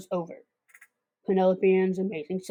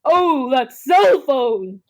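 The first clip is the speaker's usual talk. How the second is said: slow, louder, drawn-out exasperation; exasperated groan; 4 semitones higher, 7 dB louder, much slower than usual. Acted. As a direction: stretch it out like you're fed up. Callie fed up with the phone.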